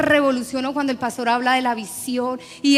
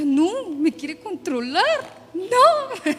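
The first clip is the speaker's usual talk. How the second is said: mock-indignant, stretched words, leaping up high on the no; indignant refusal; 5 semitones higher, much slower than usual. Churchgoer balking at the pastor's checking up, mock indignant. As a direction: balk at being controlled, voice jumping up.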